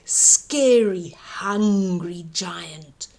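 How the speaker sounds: ordinary delivery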